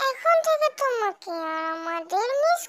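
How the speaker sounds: drawn-out gushing mother. Acted. Mother calling to her son, gushing and drawn out. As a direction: coo over her little boy.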